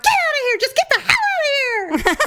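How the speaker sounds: high-pitched mock outrage; acted angry order. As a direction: order trespassers off the property. Church member orders couple away.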